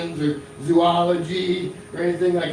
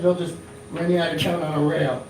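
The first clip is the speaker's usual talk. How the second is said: normal talking voice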